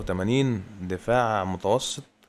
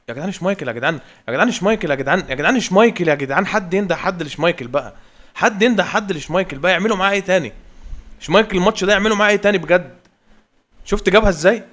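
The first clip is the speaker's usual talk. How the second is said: higher, louder outcry at the keeper; exasperated shouting; 7 semitones higher, 5 dB louder than usual. Real, not acted. Gamer frustrated that Schmeichel saves everything, loud disbelief. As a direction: cry out in disbelief. Keeper keeps saving shots.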